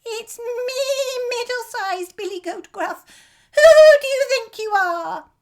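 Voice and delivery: high, bleating little goat; high frightened goat voice; terrified bleat. A narrator's reading. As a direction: quaver it out like a scared goat. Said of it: "replied in a terrified bleat".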